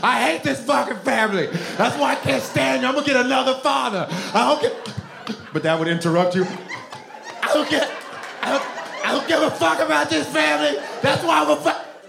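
a child's hammed-up tantrum; screaming kid. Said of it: "I would scream".

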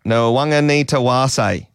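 slow, careful Nwang'anitawase. Shown as a demonstration. Presenter practises the player's name for the co-host, slowly. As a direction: draw the name out, sounding every syllable. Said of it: "Do it slower".

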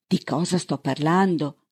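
plain reading voice

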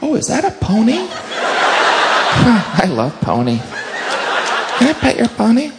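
put-on high, childlike cooing; robber charmed by the pony. A robber who spots the police horse, cooing high. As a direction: coo over the pony like a kid. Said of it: "There's some robbery".

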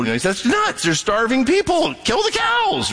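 rising to a high, exasperated cry; scoffing outsider, pitch climbing sharply; rational American. Retold or imitated. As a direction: build from blunt to a shrill demand. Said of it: "That's the rational American".

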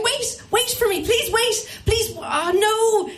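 high, slow panicked pleading; calling after the group, mock panic; desperate pleading. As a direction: beg them to wait, voice up high. Chain stuck, friends riding away.

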